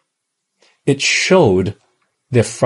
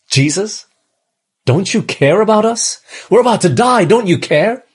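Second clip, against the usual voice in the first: panicked plea; 7 semitones higher than usual. Acted. Acting frightened disciples in storm.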